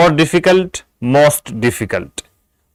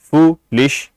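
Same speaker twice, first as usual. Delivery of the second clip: Foolish broken in two, foo, pause, lish; foolish in two separate beats; split into syllables; 2 semitones higher, much slower than usual. Shown as a demonstration. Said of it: "इसमें भी दो syllable है".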